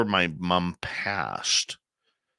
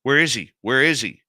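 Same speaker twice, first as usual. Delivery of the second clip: retold excited call, louder and higher; urgent excited asking; 7 semitones higher, 7 dB louder than usual. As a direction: repeat it fast, eager to see him. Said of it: "my sister's like".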